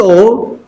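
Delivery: O cut short as a brief "oo" as in cook; short vowel. Shown as a demonstration. Teacher showing students the vowel in cook, short O. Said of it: "short vowel".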